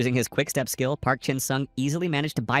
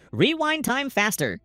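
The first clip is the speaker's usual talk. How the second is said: high, slow drawn-out yell; shout; 11 semitones higher, slower than usual. A narrator's reading. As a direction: stretch the yell out high and urgent.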